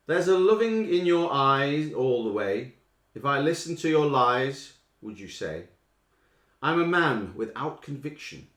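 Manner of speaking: slow, loud declaimed lines; slow lyric reading. Acted. Quizmaster reading lyrics loudly for the listeners. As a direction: read the words out slowly and loudly.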